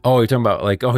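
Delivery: ordinary delivery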